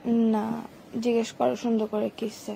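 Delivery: normal talking voice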